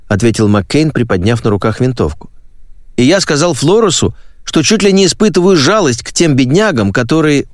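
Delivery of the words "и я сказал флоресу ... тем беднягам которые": higher, eager ingratiating voice; fawning recruit. Acted. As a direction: suck up to the sergeant, nervously. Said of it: "излучать вкрадчивую льстивость". (заискивающий голос новобранца)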